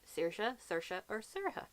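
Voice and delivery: Saoirse three ways: SEER-sha, SUR-sha, SER-a-ha; three variants. Shown as a demonstration. Listing a friend's three versions, Saoirse varied each time. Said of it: "she has told me that there's".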